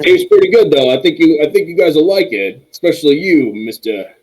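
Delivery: bright, friendly host voice; high cheery barkeep. Acted. Barkeep voice, raised pitch.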